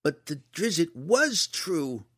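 put-on slow, loud, high-pitched boy's defiance; defiant growl. A narrator's reading. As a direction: insist on it, stretching every word. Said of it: "Liam growled defiantly".

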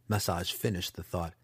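plain reading voice

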